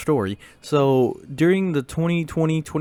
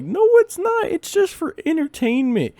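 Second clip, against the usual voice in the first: high-pitched mock-exasperated protest; exasperated objection. Arguing with a classmate about anime, high exasperated protest. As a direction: shoot up high in a retold objection. Retold argument over anime's meaning.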